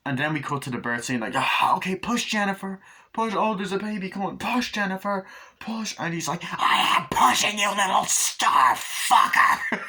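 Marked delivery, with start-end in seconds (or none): 1.4-5.9 high, hurried birth-scene urging; urgent coaching. Retold or imitated. Birth scene, urging Jennifer to push. 6.4-9.8 loud, high, strained mock yell; straining fury. Woman in labour snaps back.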